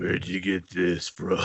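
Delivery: low, slow, soft-spoken tough guy; Hawk. Acted. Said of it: "he's still the soft-spoken Hawk".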